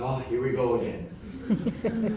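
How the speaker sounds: low, slow and soft, wearily resigned; weary sigh. Retold or imitated. Husband sighing at wife's morning dream tale, low and resigned. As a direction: groan it like a tired husband. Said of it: "I'm like".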